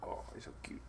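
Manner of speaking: soft unvoiced whisper. Real, not acted. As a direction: hush it so the dog stays calm. Whispering about the dog.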